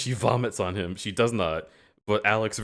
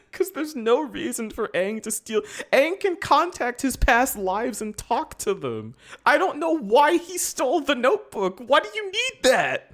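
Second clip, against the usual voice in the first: much higher and louder, incredulous; exasperated rant; 4 dB louder than usual. Real, not acted. Fan ranting about Aang stealing, exasperated high. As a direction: rant up into disbelief. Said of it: "exasperated high rant".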